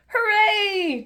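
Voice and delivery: put-on high, excited children's cheer. Peppa and George cheering the roadworks, high and excited. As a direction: shout for joy. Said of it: "cheers Peppa and George".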